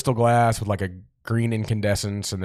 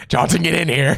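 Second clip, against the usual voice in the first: put-on louder, higher old-time boss calling out; mock boss summons; 8 semitones higher, 6 dB louder than usual. Fake boss calls Johnson into the office, hammed-up summons.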